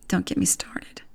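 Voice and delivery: a hushed, breathy aside to the listener; whispered aside. Real, not acted. Podcaster whispering an aside about the Chiefs ministry, hushed. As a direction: drop to a whisper, exasperated.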